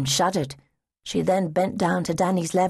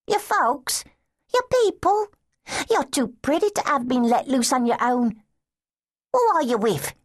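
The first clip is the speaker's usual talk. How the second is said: put-on higher, brisk street-girl voice; a tough kind lass probing gently; 8 semitones higher than usual. Street girl asking a lost child about family, higher and brisk.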